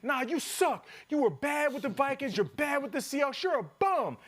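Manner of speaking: high taunting jeers; heckler insults. Retold or imitated. Fan insulting the player's career: high taunting jeers. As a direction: jeer at him like a rowdy fan.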